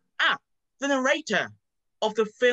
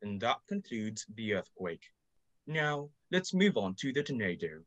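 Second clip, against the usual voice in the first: lower, softer narrator voice; documentary narrator; 11 semitones lower, 4 dB quieter than usual. Acted.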